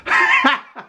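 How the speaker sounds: high burst of laughter; amused laugh. Real, not acted. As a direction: crack up laughing. Laughs at the comment.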